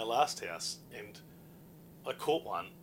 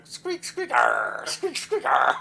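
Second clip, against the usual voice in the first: squeaky rodent breaking into pirate growls; pirate mouse noises; 10 dB louder, much slower than usual. Pretend. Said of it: "And was he going".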